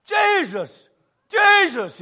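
high, loud excited shouts; excited shouting. Retold or imitated. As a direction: shout it like the crowd seeing him come. Crowd shouting at the boy.

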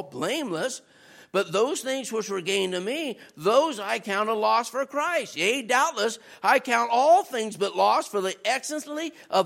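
low, steady reading from the Bible; low, solemn scripture reading to the congregation. Acted. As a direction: read the passage with weight, pitched low. Reads Philippians passage.

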